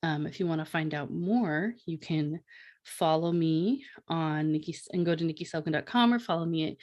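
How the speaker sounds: ordinary delivery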